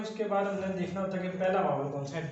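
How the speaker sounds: usual speaking voice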